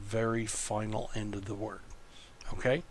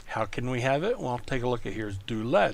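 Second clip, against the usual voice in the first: ordinary delivery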